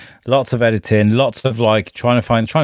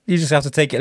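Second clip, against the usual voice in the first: ordinary talk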